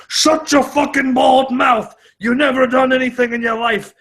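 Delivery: high, drawn-out hammed-up yelling; mock angry tirade. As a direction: hurl insults in a pretend outburst. Acts out his angry comeback to Dana.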